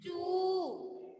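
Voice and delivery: "चू" drawn out as chooo; long vowel. Shown as a demonstration. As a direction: hold the u in "चू" long. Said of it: "लंबा हुआ".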